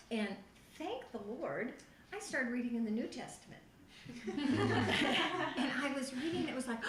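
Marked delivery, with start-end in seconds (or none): 3.9-5.5 a bright, high burst of laughter. Real, not acted. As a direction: laugh out loud with joy. Laughing while telling testimony.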